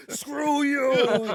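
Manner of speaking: high, drawn-out loud mock cry; mock yell. Imagining co-host grabbed by his wife, hammed-up yell. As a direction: wail it in hammed-up defiance.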